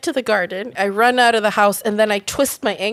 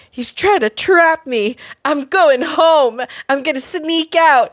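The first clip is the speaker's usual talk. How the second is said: put-on slow drunk resolve; 2 semitones higher, slower than usual. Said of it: "I was like".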